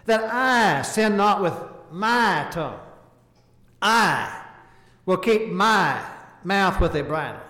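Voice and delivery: each "I" set apart by a pause before the rest; stressing the pronouns. Shown as a demonstration. Preacher showing the psalmist's personal pronouns, stressing "I". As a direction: lean on every "I", slow and deliberate. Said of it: "Listen to the way he said it".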